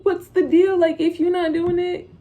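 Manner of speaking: loud, high, defiant comeback; defiant retort. Retold or imitated. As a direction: push back at nosy family. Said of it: "I'ma just be like".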